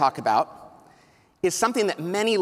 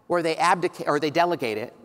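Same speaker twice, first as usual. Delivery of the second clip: ordinary talk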